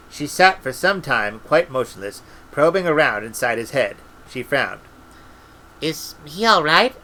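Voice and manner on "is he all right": anxious. A narrator's reading. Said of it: "said Esk anxiously".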